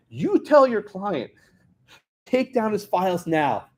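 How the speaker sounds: slow, high-pitched, imperious order; mock governor issuing an ultimatum; imitated demand. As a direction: lay down the order word by word. Governor giving order, acted out.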